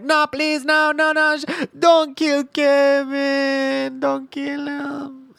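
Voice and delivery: high wailing plea, words dragged out; hammed-up, slow, high-pitched begging; screaming in terror. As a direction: imitate a coworker shrieking for mercy. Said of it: "Jesse's screaming".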